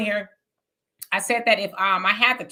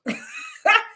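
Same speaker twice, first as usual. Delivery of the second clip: high squeal laugh. Real, not acted. Laughing at caller's comment.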